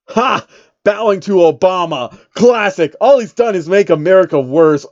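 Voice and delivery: loud mocking scoff; scoffing rant. Rapper scoffing at bowing, loud. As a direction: sneer at the idea, loud.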